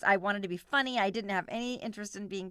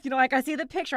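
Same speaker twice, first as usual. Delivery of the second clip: ordinary delivery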